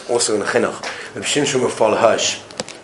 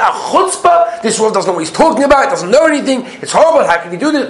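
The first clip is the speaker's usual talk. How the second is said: high, loud, fast outraged rant; sputtering outrage, pitched up and loud; indignant complaint; 10 semitones higher, 4 dB louder than usual. Acted. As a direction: blow up at the Rav in disbelief. Angry man complaining about the Rav.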